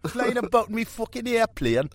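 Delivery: put-on high Geordie accent; a Geordie rock star bragging about his airplane. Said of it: "The old Geordie".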